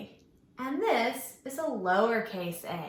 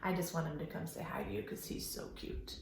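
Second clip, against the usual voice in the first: soft quick aside; 5 semitones lower, 12 dB quieter, faster than usual. Acted. Aside about the alligator puppet.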